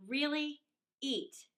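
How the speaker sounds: ordinary delivery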